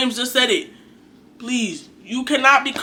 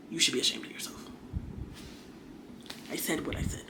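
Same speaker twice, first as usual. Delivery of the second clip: very soft, low, half-whispered reproach; quiet, low-pitched telling-off; hushed scolding; 7 semitones lower, 18 dB quieter, faster than usual. Real, not acted. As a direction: say it under your breath, low and pointed. Scolding aside after a pause.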